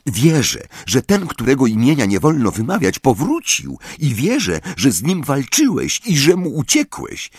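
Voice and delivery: put-on high-pitched earnest girl's voice; blurted declaration. A narrator's reading. Luna telling Harry she believes him, blurting it out. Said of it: "wzięła głęboki oddech i wypaliła".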